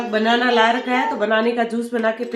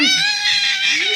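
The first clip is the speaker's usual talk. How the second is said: a long, slow, laughing squeal way up high. Real, not acted. Mother laughing as the kids fight, squealing high. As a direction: stretch it out high while laughing.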